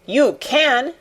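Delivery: restrained little shout; shouted retort. A narrator's reading. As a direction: snap back at the sobbing boy. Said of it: "shouted Mary".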